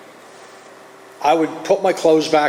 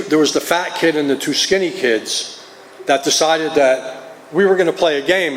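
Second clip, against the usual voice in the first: normal talking voice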